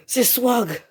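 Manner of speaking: high, loud excited exclamation; thrilled surprise. Real, not acted. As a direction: blurt out a delighted recognition. Recognises another player. (exclamation surexcitée)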